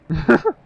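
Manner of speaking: high loud burst of laughter. Real, not acted. Bursts out laughing at own count.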